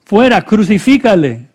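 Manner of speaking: high, loud mob cry; shouting. A narrator's reading. Crowd rejecting Jesus before Pilate, shouting. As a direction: shout it as the crowd, punching each demand. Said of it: "ellos gritaron".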